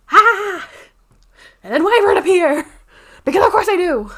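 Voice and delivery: loud, high gleeful shriek; excited outburst. Real, not acted. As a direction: burst out at the twist, thrilled. Dramatic outburst at plot twist.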